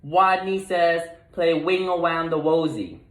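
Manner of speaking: lightly voiced little rodent leading; bolder call. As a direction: call out the next order. Wadney calling the game.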